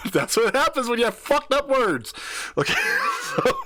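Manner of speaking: high and loud through laughter; cracking up mid-sentence; laughing. Real, not acted. Host cracking up at own story.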